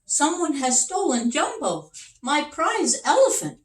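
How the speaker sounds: high, loud, distressed king voice; upset king. Acted. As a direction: plead as the robbed king. King reports stolen elephant.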